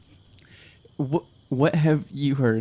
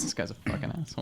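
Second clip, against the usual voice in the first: muttered aside; 9 dB quieter, faster than usual. Acted. Muttered note-writing aside.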